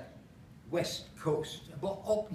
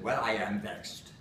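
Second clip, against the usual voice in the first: lightly northern Lancashire lilt for a comic recitation; Lancashire accent; 11 semitones lower than usual. Acted. Performer reciting Holloway monologue to audience, Lancashire accent. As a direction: recite it in broad Lancashire English. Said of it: "so I have to do it in an accent".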